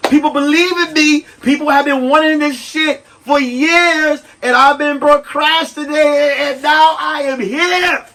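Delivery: rising excited shout; hype. Real, not acted. Announcing his show's arrival, excited shout. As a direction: pump up the crowd. Hyped sign-off.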